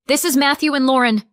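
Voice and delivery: high loud venomous accusation; spitting anger. A narrator's reading. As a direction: spit the names out. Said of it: "she spit".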